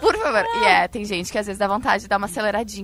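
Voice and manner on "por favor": high, loud exasperated please. Retold or imitated. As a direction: plead loudly for them to hurry. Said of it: "E tu fica assim".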